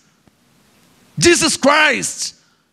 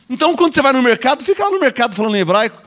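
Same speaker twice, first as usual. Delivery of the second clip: ordinary talk, nothing marked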